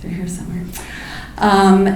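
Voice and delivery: ordinary talk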